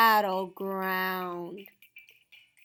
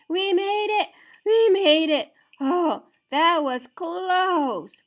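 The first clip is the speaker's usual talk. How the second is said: relieved laughter; 9 semitones higher, 8 dB louder than usual. Acted. Relieved crowd.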